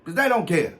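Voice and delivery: normal talking voice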